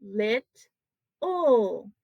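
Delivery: "lit, ul" as two separate beats; teacher splitting the word in two; syllable by syllable. Shown as a demonstration. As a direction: pause between "lit" and "ul". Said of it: "That's how I say this word".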